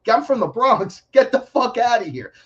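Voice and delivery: high-pitched, brash New Yorker outburst; defiant comeback. Retold or imitated. Acted defiant comeback in argument.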